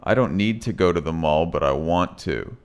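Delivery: fast, middle "to" clipped, final "to" full; reduced then full to. Shown as a demonstration. Teacher showing "to" in middle versus end. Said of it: "this is how it sounds".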